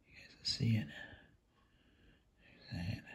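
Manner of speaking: soft unvoiced whisper. Real, not acted. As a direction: breathe the words, no voice. Whisper heard while eating.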